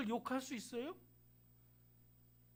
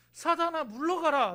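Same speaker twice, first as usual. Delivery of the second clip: loud, firm command to Satan; commanding rebuke; 6 semitones higher, 8 dB louder than usual. Shown as a demonstration. Pastor shows the congregation how to rebuke Satan, loud command. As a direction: order the devil away with force. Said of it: "라고 얘기를 하세요".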